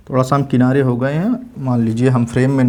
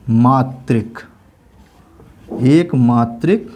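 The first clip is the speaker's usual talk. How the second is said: slow dictation; 4 semitones lower, slower than usual. Shown as a demonstration. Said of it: "को एक मात्रिक".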